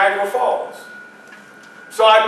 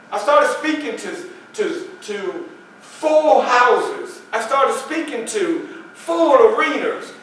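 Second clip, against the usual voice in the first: ordinary talk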